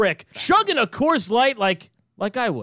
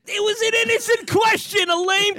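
hammed-up hurt protest; mock indignant; 10 semitones higher than usual. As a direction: whine it like an offended team. Mocking the Patriots' complaint.

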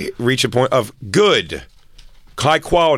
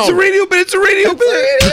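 very high, louder squeal; mock squeal; 3 dB louder than usual. Mocking her panicked plea to the cop, squealed very high.

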